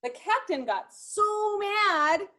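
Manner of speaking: usual speaking voice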